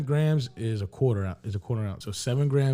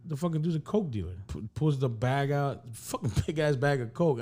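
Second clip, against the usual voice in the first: ordinary talk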